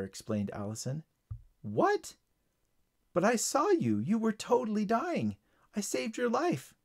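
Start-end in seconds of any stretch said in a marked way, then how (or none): 1.6-2.2 high, startled question; shocked. Acted. Tyler reacting to Allison's news, shocked. 3.1-6.7 high-pitched, insistent protest. Acted. Tyler arguing he saved Allison, insistent. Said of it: "insisted Tyler".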